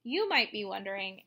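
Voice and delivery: usual speaking voice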